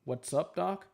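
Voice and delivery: a light attempt at the cartoon rabbit's catchphrase; Bugs Bunny impression. Streamer trying the rabbit's catchphrase for viewers, lightly voiced. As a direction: toss off the rabbit's greeting, barely changed. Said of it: "That's my Bugs Bunny impression".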